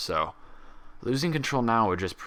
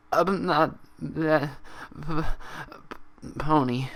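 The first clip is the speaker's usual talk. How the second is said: high, slow, hiccuping stutter; stammering through tears; 8 semitones higher, much slower than usual. Acted. Pinkie repeating after Applejack, stammering tearfully. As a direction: fight back the sobs. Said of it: "she stammered and hiccuped".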